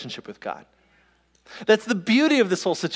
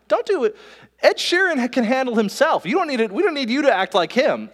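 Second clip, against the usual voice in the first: high-pitched, louder mock exasperation; exasperated coaching; 8 semitones higher, 4 dB louder than usual. Voice coach stopping a singer copying others, exasperated imitation.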